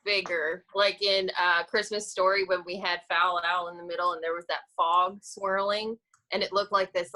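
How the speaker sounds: ordinary talk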